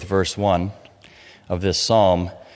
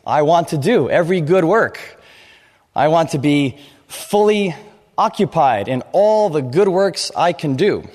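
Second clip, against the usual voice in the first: eager believer; 7 semitones higher than usual. Retold or imitated. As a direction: play the zealous go-getter ready for every good work. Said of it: "We say".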